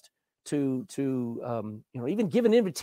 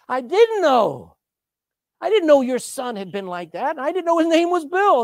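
loud, high-pitched astonished denial; flabbergasted protest; 8 semitones higher, 8 dB louder than usual. Retold or imitated. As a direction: play the pastor protesting in amazement. Retold flabbergasted pastor answers.

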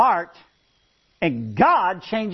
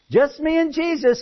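parrot voice; 8 semitones higher than usual. Retold or imitated. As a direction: answer from the dark like a parrot. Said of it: "a voice says".

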